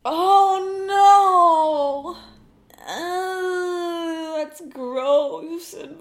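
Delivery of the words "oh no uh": loud, pained oh no; dismayed groan. Real, not acted. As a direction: groan at the terrible news. Reacting to band name.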